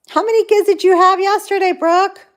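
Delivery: high, fast, excited question. Real, not acted. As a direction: ask it all excited.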